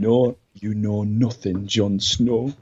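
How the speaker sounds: slow, acted northern English line; northern English accent. Brit correcting his co-host's quote, slow northern accent. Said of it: "I love northern accent".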